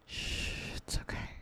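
barely there whispered shh; hushing. Acted. Mommy soothing her crying sweetie, faint whispered hush. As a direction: hush softly, almost breath only.

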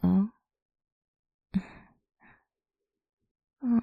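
ordinary talk, nothing marked

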